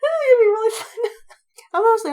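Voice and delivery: giddy laughter bubbling over; laughing excitedly. Real, not acted. Writer thrilled about her new story, laughing.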